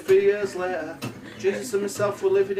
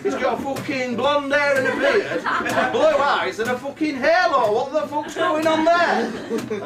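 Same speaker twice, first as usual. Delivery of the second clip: higher, worked-up comic rant; paranoid outrage; 5 semitones higher, 3 dB louder, slower than usual. Acted. Joseph challenging Mary over the baby's looks, acted outrage. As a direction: build into an incredulous, high protest.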